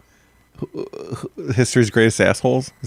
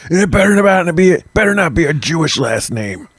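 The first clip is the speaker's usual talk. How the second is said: higher-pitched mock warning; mock threatening demand; 7 semitones higher than usual. Nazi delivery man checking a signature, mock threatening. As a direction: warn them like a fed-up enforcer.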